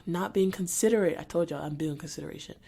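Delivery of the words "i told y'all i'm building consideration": ordinary talk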